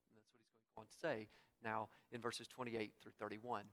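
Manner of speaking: normal talking voice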